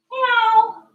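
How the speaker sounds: high squeaky cat meow. Pretend. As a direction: meow like a playful kitten. Kids yoga cat cow sounds.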